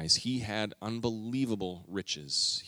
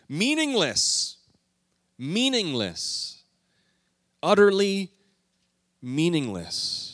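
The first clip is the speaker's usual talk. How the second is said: slow, stretched and high, each meaningless falling; dramatic reading; 8 semitones higher, 4 dB louder, much slower than usual. Acted. Preacher quoting Solomon's opening lament, slow and dramatic. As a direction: lament it, slowly, word by word. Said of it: "dramatic scripture reading".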